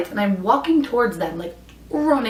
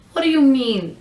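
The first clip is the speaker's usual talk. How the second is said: incredulous; 4 semitones higher than usual. Retold or imitated. Incredulous reply to the driver.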